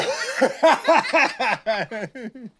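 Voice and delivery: loud helpless laughing; ticklish laughter. Real, not acted. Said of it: "You tickle monster".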